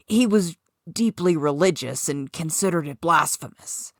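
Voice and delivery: ordinary delivery, nothing marked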